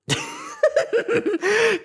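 high-pitched giggle; high laugh. Real, not acted. Host laughs at their own shaky history, high giggle.

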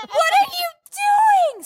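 high, slow, tearful scream. Retold or imitated. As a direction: shriek it out slowly, close to crying.

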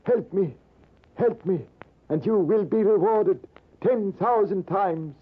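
put-on a buried old man begging to be dug out; old Persian man crying for help. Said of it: "and he shouted".

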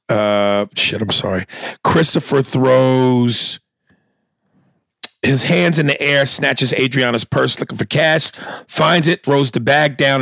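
ordinary delivery

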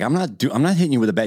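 high, startled refusal. Retold or imitated. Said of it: "He's like".

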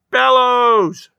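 loud, high shout; indignant cry. A narrator's reading. Old lady supplying her forgotten name, crying out. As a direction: shout out your own name. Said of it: "she cried".

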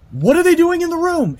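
scared voice in a nightmare; panicked question. Retold or imitated. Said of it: "And I go".

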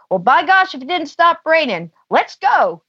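cheerful call. Retold or imitated. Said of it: "and he goes".

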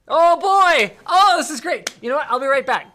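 thrilled high exclamation; excited. Real, not acted. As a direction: burst out with delight. Host finds something delightful.

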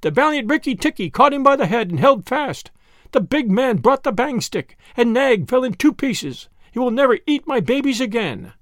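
high, bright-voiced chattering tailorbird; a proud bird praising its hero; excitable little bird. Tailorbird boasting about the mongoose's victory, high bird voice.

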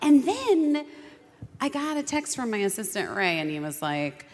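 usual speaking voice

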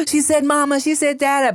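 high, thrilled retold cry; excited. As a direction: squeal it like a proud new parent. Retelling excited parents.

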